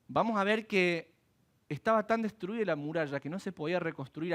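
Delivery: ordinary delivery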